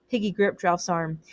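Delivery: usual speaking voice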